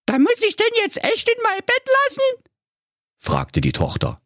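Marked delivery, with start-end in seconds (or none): 0.1-2.5 very high squeaky protest; whiny fairy-tale girl; dismayed princess Carola. Acted. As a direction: ask daddy in piping disbelief. Voices Carola questioning father.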